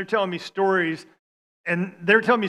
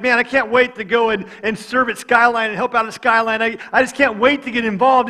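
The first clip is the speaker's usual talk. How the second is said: eager businessman; 5 semitones higher, 8 dB louder than usual. Retold or imitated. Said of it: "I'm like".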